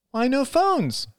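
put-on high-pitched little boy's question; high, childish protest; child's cry. A narrator's reading. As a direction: cry it out like a small kid. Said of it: "cried Ben".

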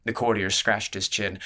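plain reading voice

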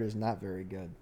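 ordinary delivery

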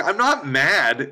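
usual speaking voice

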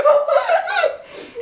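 high peal of laughter. Real, not acted. Telling how Lily cursed James, high laugh.

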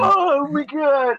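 high, loud, slow "Oh my God"; mock shocked gasp. Everyone reacting to the riddle, hammed-up shocked gasp. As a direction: stretch it out in exaggerated disbelief. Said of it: "everyone's like".

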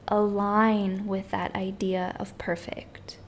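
airy whispered question. Acted. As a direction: let it fall to a whisper.

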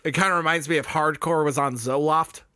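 ordinary delivery

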